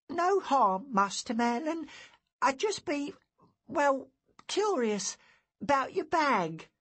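put-on high-pitched, hesitant boy's voice; sheepish boy. A narrator's reading. Guilty boy explains himself to Master Merlin, sheepish. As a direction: play a curious lad caught out.

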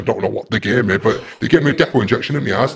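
normal talking voice

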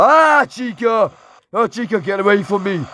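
high startled cry of a name; real high startled shout. Real, not acted. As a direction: shoo the robot off in fright. Chica appears.

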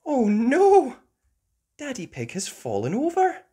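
high, loud alarmed cry. Acted. Peppa seeing Daddy fall, high alarmed.

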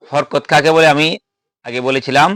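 ordinary talk

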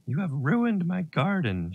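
slow, high-pitched angry outcry; scolding mother's drawn-out shout, read aloud. A narrator's reading. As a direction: cry it out high, stretching the words in outrage. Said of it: "she shouted".

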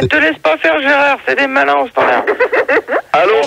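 high-pitched heckling; high excited voice. Real, not acted. Shouting at the host.

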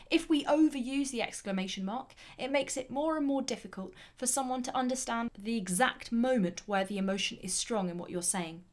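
usual speaking voice

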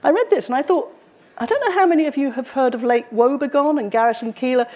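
ordinary talk, nothing marked

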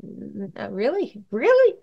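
mock the husband's disbelief, pitch jumping up on the last word; incredulous really. Wife imitating her husband doubting he sounds harsh, incredulous. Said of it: "you're like".

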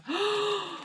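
real gasp; sharp delighted gasp. Real, not acted. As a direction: catch your breath in surprise. Gasp at goth necklace.

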